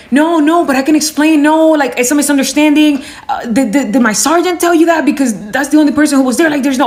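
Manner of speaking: imitating a husband scrambling to explain, pitched high; mock flustered, high-pitched protesting; panicked rambling. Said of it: "he just started rambling".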